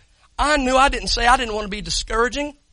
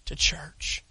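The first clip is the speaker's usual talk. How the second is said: whisper; 5 semitones lower, 8 dB quieter than usual. Real, not acted. Thanking Ricky, soft ending.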